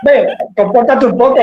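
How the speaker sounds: mock friend nagging him to behave; friend's scolding.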